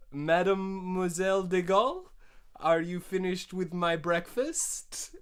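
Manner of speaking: hammed-up French husband calling out; French accent. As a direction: Charles de Gaulle in a jokey French accent asking for breakfast. Charles de Gaulle voiced in story.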